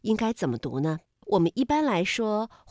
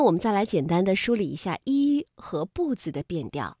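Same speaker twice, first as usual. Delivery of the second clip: ordinary delivery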